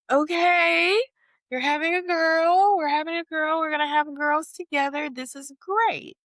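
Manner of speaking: high, loud singsong delight; mock excited gushing. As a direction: gush with hammed-up joy, stretching the last words. Imitated shared delight over girls.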